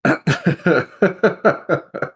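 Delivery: a bright burst of laughter; real laughter breaking in; high real laugh. Real, not acted. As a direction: laugh out loud, rising. Player laughs at the joke.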